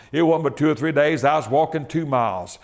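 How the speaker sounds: ordinary talk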